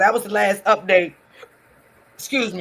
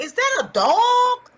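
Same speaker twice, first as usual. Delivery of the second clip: surprised question; 4 dB louder, slower than usual. Retold or imitated. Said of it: "I'm like".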